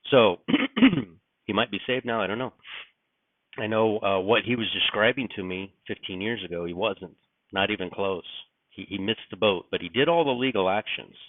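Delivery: ordinary talk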